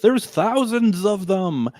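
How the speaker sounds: high, slow movie-doom line; mock dramatic dread. Closing line of the film, hammed-up doom, high and slow. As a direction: deliver it like a cheesy disaster film ending. Film's final line quoted.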